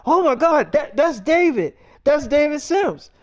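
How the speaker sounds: high, loud starstruck squeal; excited fan. Retold or imitated. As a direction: spot a celebrity and squeal. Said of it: "they was like".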